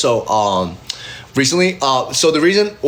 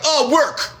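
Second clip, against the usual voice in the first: evasive answer; 13 semitones higher than usual. Retold or imitated.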